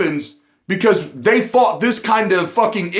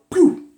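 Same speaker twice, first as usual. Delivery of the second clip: high mouth gunshot noise; a quick high pew; 11 semitones higher than usual. Retold or imitated. Imitating a gunshot.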